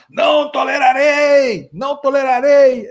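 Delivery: high, slow, shouted bluster; mock bravado shout. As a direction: bellow it like a mock tough guy. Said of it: "A bravata dele".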